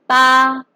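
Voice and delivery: long level "pa" without rise or fall; first tone. Shown as a demonstration. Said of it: "obviously be on A".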